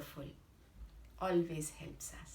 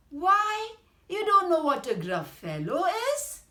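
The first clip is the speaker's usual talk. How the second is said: much higher and louder, fast astonished mouse; can't believe the fox doesn't know; amazed mouse; 11 semitones higher, 10 dB louder than usual. Acted. Mouse astonished at the fox, high fast exclaiming. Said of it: "said the little mouse".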